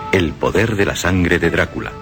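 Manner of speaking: ordinary delivery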